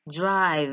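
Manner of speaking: "drive" loud, with a long buzzing v at the end; very strong final V. Shown as a demonstration. Teacher showing learners the last word, very strong V. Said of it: "a very strong V sound at the last".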